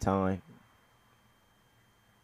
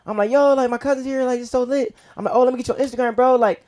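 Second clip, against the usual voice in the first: high, loud gushing; excited gushing; 13 semitones higher, 5 dB louder, faster than usual. Retold or imitated. Telling mom and the cousin how excited he is. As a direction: hype it all up.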